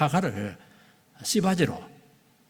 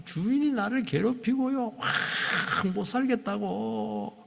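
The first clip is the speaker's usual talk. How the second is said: high, drawn-out hammed-up lament; complaining; 8 semitones higher, slower than usual. Preacher voices Hagar complaining about her master, whining protest. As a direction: pour out the grievance, stretching into a mock wail. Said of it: "막 불평을 얘기합니다".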